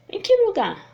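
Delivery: emphatic teaching-demo question; demonstrated question. Shown as a demonstration. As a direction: ask it high and emphatically, falling at the end. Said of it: "Essas são as interrogativas".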